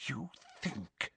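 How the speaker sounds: very soft, threatening whisper; menacing whisper. Acted. Umbridge has Harry by the hair, whispering menacingly. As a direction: whisper it slowly into the victim's ear. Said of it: "she whispered".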